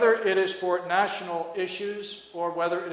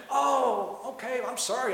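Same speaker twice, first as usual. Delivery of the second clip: lightly acted, higher-pitched apology; apologetic God; 5 semitones higher than usual. Preacher voices God apologizing to Joe, mock apology. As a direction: play a mock-flustered God caught not listening. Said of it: "maybe I'll get God to say".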